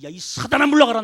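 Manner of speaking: loud commanding rebuke building to a shout; stern rebuke. Retold or imitated. Preacher voicing Jesus rebuking Peter, loud command. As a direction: drive Satan away with force.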